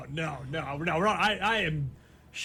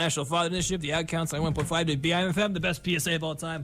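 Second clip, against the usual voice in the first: ad read; faster than usual. Acted. Reads PSA ad credits.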